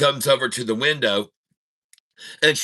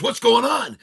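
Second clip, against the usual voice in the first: startled; 12 semitones higher, 4 dB louder than usual. Acted. Husband startled in car.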